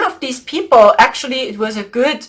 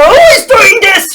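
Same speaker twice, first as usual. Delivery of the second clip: higher, louder furious question; angry yell; 8 semitones higher, 7 dB louder than usual. Retold or imitated. The dracos demand who did it, angrily shouting. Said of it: "They were so angry".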